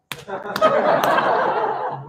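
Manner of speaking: high, wheezing burst of laughter that runs out of breath; helpless high giggle, cracking up; showing the final laugh. Shown as a demonstration. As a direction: let out the big knee-slapper laugh. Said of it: "one final step in laughing".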